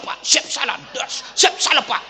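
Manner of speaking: mock parade-ground "siap salah"; barked military yes-sir. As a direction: snap it out like a scolded soldier. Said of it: "dimarah-marahi sama bupati".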